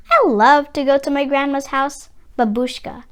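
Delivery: plain reading voice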